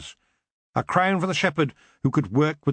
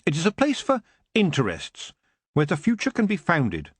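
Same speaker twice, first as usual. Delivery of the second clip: put-on higher, earnest girl's voice; young Tiffany; 5 semitones higher than usual. Tiffany explaining sheds to the elf king, earnest. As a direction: speak up brightly and sincerely.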